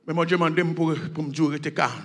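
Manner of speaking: low, soft, comforting scripture recitation; gentle reassurance. Acted. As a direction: soothe the listeners, hushed and tender. (pasteur qui rassure doucement, voix basse)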